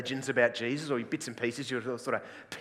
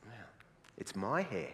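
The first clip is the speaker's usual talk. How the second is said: unfazed reply; 3 semitones lower, 8 dB quieter than usual. Acted. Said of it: "I go".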